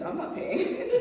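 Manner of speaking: laughing. Retold or imitated.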